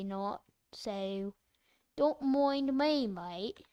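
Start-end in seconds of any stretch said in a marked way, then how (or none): none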